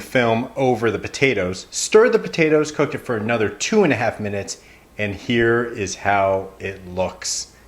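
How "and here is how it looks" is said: slow reveal with a long pause before it; dramatic pause. Shown as a demonstration. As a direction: hold the pause, then unveil it slowly. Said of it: "Now notice that dramatic pause".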